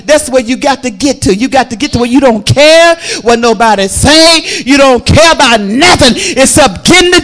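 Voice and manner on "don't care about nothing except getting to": desperate woman. Acted. Preacher acts the desperate woman.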